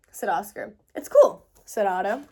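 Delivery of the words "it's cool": excited. A narrator's reading. Otto's cheerful reply.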